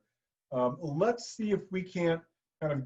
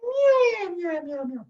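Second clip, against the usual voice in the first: tiny Mickey Mouse voice; 14 semitones higher, 6 dB louder, much slower than usual. Shown as a demonstration. Teacher shows a tiny high Mickey Mouse meow. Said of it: "little Mickey Mouse voice".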